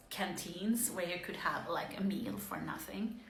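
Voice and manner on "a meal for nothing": ordinary delivery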